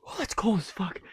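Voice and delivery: high, loud yelp at the cold; high startled yelp at a cold drink; cold shock. Real, not acted. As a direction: gasp out the shock of ice cold.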